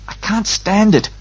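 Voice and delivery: raging inwardly, voice jumping up; silent rage. A narrator's reading. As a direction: protest in contained, high-pitched anger. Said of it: "Garion raged silently".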